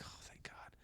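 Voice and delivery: breathy whispered relief. Retold or imitated. Relieved quote, voiceless.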